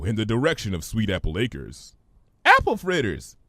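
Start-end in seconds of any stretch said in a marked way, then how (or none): 2.4-3.4 much higher, bubbly pony; Pinky's voice. Acted. Pinky puzzled by Twilight's big word, high voice.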